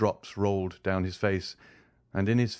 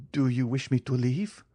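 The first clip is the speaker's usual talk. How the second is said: a touch of sadness, higher and pleading; sadly; 5 semitones higher than usual. A narrator's reading. Morrel asking the silent old man if he must go, mildly sad. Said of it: "said Morrel sadly".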